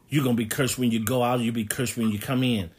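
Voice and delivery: usual speaking voice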